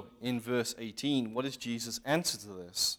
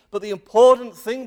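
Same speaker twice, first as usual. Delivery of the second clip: louder and higher preaching burst; emphatic preaching; 8 semitones higher, 14 dB louder than usual. Real, not acted. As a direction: hit the main point hard. Preacher drives home his point.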